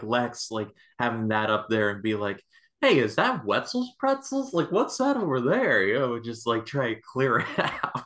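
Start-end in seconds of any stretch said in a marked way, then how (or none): 2.8-5.8 high, swooping mock-innocent question; exaggerated, high-pitched innocent curiosity; fake casual distraction. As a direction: divert attention far too obviously. Imagined Lex faking a distraction.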